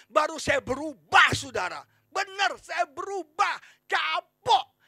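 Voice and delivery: high, shouted, emphatic sermon; full-force preaching. Real, not acted. Preacher on being provoked into change, full-force preaching. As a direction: shout each point to the congregation.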